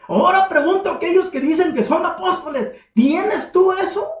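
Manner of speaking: high, loud, slowing on the question; loud challenge. Real, not acted. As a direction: throw the question at the congregation. Preacher challenges false apostles. (pregunta desafiante, alta y fuerte)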